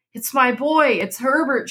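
high, urgent cry; pleading cry. A narrator's reading. Mother insisting to her husband it's their son, high pleading cry. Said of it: "she cried".

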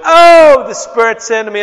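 loud, high, hammed-up preacher shout; mock hype shout. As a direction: ham up an overexcited preacher. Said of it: "there was no".

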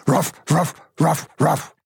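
mock dog barking in words. Policeman's question heard as a dog's bark, mock barking. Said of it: "then barked".

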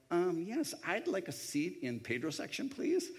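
polite request with a high lilting "please"; mock polite request. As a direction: imitate diners hoping for a favorite table. Mimics another couple asking for seat.

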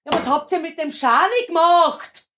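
rising alarm. Acted. Acted stage scene, rising alarm.

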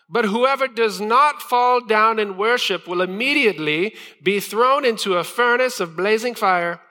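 high, sustained proclaiming voice; herald. Acted. Herald warning the people of the furnace, high proclamation. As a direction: announce the decree like a crier, pitched far up. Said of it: "that's what a herald sounds like".

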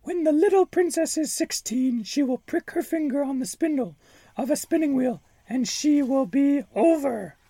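high, menacing witch voice; shrill-free high cackling-witch menace; wicked fairy. Acted. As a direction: sneer it like a spiteful fairy.

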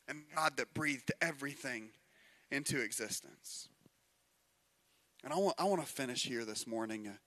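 soft, low, hushed sermon voice; quiet intimate preaching. Acted. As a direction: draw them in close and quiet.

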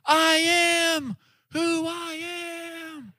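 high, fading movie-scene call; distant voice through fog. Acted. Girl answering through the misty fog, faint mock-dreamy call. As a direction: float it out high and drawn, dying away. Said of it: "you just hear through the fog".

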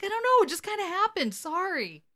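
high-pitched feeble apology; mock sheepish excuse. Gary excusing himself to Dean about the maid, mock sheepish. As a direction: squeak out a half-hearted excuse.

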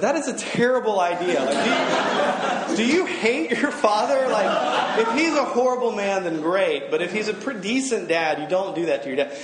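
high, loud and laughing, mock-horrified; a laughing, disbelieving high outburst; incredulous laughing retort. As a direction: play the friend balking at the idea through laughter. And Dawn said, friend's terrible idea.

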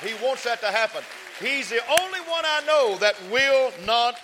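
high, loud preacher's call; rising sermon shout; full-force preaching. Acted. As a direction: drive it home to the congregation.

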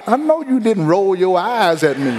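laughing parent's warning; stern parent. Retold or imitated. Parent catching child rolling eyes, laughing warning. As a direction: catch the kid out, half laughing.

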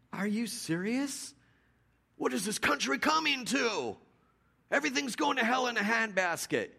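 high-pitched hammed-up scolding rant; exasperated mock Paul. A preacher imagines Paul berating the Athenians, mock outrage. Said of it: "what if Paul would have gone in there and said".

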